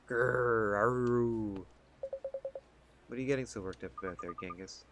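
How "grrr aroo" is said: dog growl. Pretend. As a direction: growl then a dog's howl.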